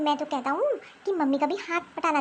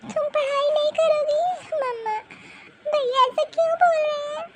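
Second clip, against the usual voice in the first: put-on high child voice, crying while complaining; a hurt girl sobbing out everything to her mother; tearful little girl; 8 semitones higher, 4 dB louder than usual. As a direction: daughter crying to mama about brother and grandma.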